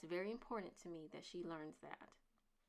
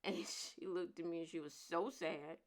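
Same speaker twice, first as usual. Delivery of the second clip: normal talking voice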